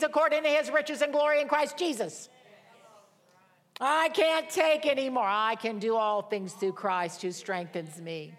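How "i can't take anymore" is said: mock complaint. Voicing a weary complaint.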